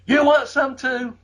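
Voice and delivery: high, slow threatening challenge; mock yell. Buddy challenging the ringside fan, slow high mock yell. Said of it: "Buddy just yelled that out".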